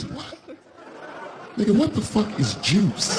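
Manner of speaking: high, slow disbelief; baffled outburst. Retold or imitated. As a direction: act floored by the word juice.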